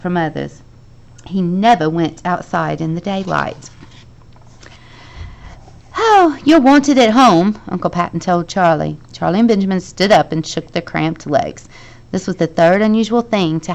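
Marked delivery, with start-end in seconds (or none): 6.0-7.6 slow loud announcement. A narrator's reading. Uncle announces loudly.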